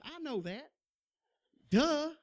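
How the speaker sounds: a cheeky high, loud duh; sarcastic duh. Retold or imitated. Young man mocks friend's obvious advice with duh. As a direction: toss the duh off mockingly.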